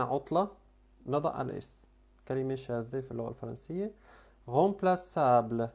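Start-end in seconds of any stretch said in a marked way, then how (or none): 4.5-5.7 remplaçable said very slowly, ç as s; slow demo word. Shown as a demonstration. Teacher modelling remplaçable slowly for students. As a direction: draw out remplaçable syllable by syllable. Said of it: "سي سي دي".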